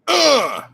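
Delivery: sharp, high rap-style grunt; rap ad-lib grunt. Retold or imitated. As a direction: bark it like a hip hop ad-lib. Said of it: "the guy goes".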